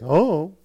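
"Oh" hummed as a wordless I-don't-know; mumbled teen shrug. Retold or imitated. Kid dodging a parent's question, "Oh" as a mumbled hum. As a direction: slur "Oh" into one dipping, rising mumble. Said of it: "It sounds like this".

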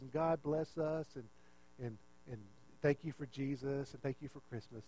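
soft, low bedtime prayer; imitated hushed praying; quiet prayer. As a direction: murmur a humble child's prayer. First brother praying quietly at bedtime.

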